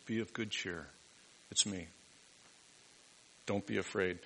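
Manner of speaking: soft, gentle reassurance; calm voice. Retold or imitated. Jesus calmly reassures the terrified disciples in the boat. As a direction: settle frightened men with quiet words. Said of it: "speaks to them with this calm voice".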